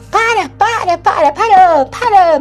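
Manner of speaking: a doll snapping at the voices to stop; doll yelling stop. Retold or imitated. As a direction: cut them off with a yelled stop. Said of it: "E a boneca fala".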